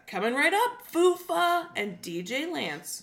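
high, loud cheery presenter imitation; hammed-up sing-song announcement; kids' show host. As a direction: announce it like children's TV. Voicing the show's cheerful reply.

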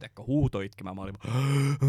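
normal talking voice

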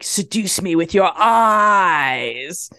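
very slow, drawn-out mock director; slow sleazy order. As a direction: purr the line like a creepy coach. Stryker's slow demand, imitated.

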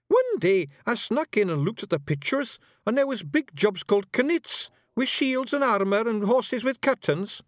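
high, loud wee Scottish fairy; rowdy little Scots pixie voice; broad Scottish accent. Acted. Billy continues.

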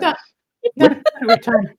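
high, fast little bat voice. Acted. GM voicing the bat.

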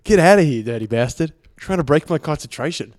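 put-on Australian accent; amateur Australian impression, played for laughs; Steven Adams. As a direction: mimic an Aussie athlete telling someone off. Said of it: "rating my Australian accent, my Steven Adams impression".

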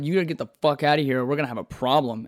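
ordinary delivery, nothing marked

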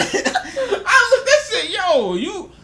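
high, slow, through laughter; laughing. Real, not acted. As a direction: laugh the words out. Laughing retelling of shock.

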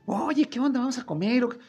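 imitating the boss, high and casual; relaxed boss. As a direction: toss it off in a high, easygoing mock-boss voice. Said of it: "Dije, ya bajó la entonación".

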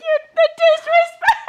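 very high, loud indignant squeal; hammed-up offended shriek; mock outrage. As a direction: squeal it, scandalised.

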